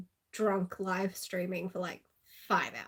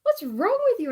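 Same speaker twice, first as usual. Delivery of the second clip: mom's outburst; 12 semitones higher, 7 dB louder than usual. Retold or imitated. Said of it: "She says".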